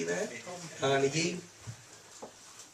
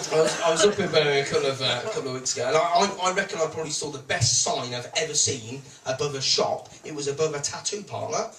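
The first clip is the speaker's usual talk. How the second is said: ordinary delivery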